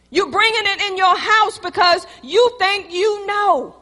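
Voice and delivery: loud, high, rising sermon climax; preaching at full force. Acted. Pastor rebuking the stubborn congregation, preaching loud. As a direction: call the rebels to repent.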